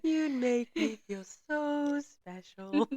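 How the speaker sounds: teasing lovestruck imitation; Gary impression. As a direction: stretch it out in mock-romantic earnest. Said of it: "how Gary talks".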